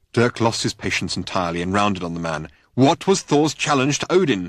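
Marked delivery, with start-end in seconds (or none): 2.7-4.5 higher and louder insistence; angry insistent demand. A narrator's reading. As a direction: round on him and demand it. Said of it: "he insisted angrily".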